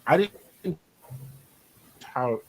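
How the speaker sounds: choppy broken-up call audio; robotic glitchy audio. Real, not acted. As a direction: voice breaking up like a bad connection. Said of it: "You sound like a robot".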